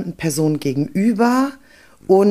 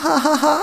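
silly laugh; 10 semitones higher than usual. Retold or imitated. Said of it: "hat so eine blöde Lache".

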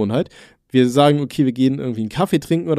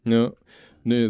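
ordinary talk

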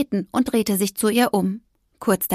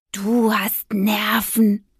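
slow, whispered mewing; whispered cat mew; much slower than usual. Acted. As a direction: breathe it out like a wary cat. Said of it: "maunzte Zenturo". (Kater maunzt flüsternd)

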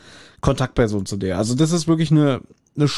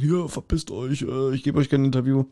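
mock rude brush-off; 5 dB quieter than usual. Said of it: "ganz unwirsch und eklig sein, so zu Journalisten".

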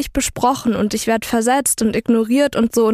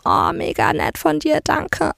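higher, sugary mock thanks from him; mock sweet thanks; 5 semitones higher than usual. Imitating the guy thanking her in a higher voice. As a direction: parody his overly nice thank-you. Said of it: "Und er war so".

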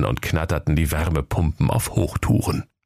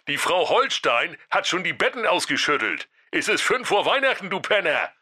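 higher, softer, fast nagging voice; Jakob scolding; 7 semitones higher, 4 dB quieter than usual. Acted. Brother scolding sleepy Santa, fast high nagging. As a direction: rattle off the scolding, quick and high. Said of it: "sein Bruder Jakob rief".

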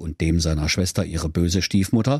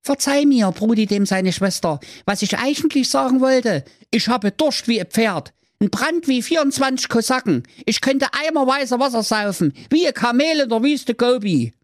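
much higher boy voice, exaggerating; little brother; 13 semitones higher than usual. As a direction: boast like a thirsty kid. Brudi figure.

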